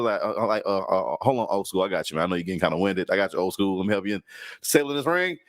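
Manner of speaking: ordinary talk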